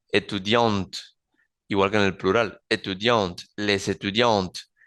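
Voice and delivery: Étudiante with the final T clearly sounded; étudiante ending on a crisp T; final T demo. Shown as a demonstration. Teacher pointing out the T in the feminine. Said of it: "en el femenino suena esa T".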